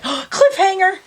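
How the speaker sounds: gasp, then cry the word out way up high; playful fake shock, high and loud; mock dramatic gasp. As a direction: ham it up like a TV cliffhanger. Mock drama as battery dies.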